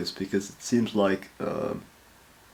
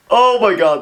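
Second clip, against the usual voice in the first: excited exclamation; 10 semitones higher, 8 dB louder than usual. Real, not acted. Reacting to badass scene.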